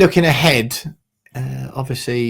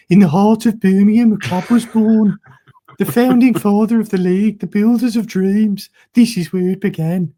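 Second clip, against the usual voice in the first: higher, louder mock Brummie reading of a grand club blurb; Brummie accent; 7 semitones higher, 4 dB louder than usual. Shown as a demonstration. Podcaster reads the Villa website in a put-on Brummie accent. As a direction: ham up a Birmingham accent, half laughing. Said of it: "I should do it in a Brummie accent".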